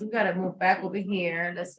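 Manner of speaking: ordinary delivery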